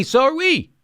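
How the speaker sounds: high, sing-song me-too reply; mocking Democrat. Mocking corporate Democrats agreeing with Republicans, high and sing-song. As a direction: chirp it back like a yes-man. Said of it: "that's why they sound like this".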